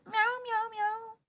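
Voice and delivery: high playful cat mewing; cat meow. Pretend. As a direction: meow like a kitten. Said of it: "do you like my ears".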